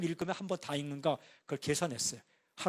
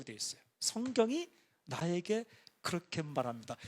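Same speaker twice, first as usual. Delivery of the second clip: normal talking voice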